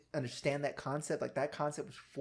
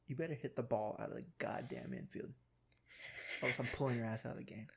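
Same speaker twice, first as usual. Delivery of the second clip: low threat; 2 semitones lower, 10 dB quieter than usual. Retold or imitated. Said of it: "he's like".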